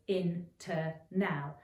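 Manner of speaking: "in-ter-now" split into three slow beats; syllable by syllable. Shown as a demonstration. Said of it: "internal has three syllables".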